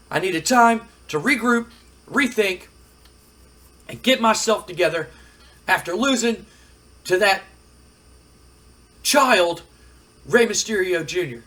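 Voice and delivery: loud, high, emphatic acted frustration; worked-up wrestler sore about losing; bitter wrestler. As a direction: punch out each phrase with pauses between.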